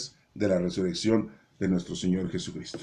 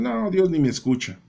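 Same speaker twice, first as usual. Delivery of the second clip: doubting believer; 8 semitones higher, 3 dB louder than usual. Retold or imitated. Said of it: "Otros dicen".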